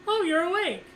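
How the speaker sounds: high-pitched imitated nurse greeting; lightly mimicked surprised nurse. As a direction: greet a waking patient in a high singsong. Voices nurse greeting waking patient.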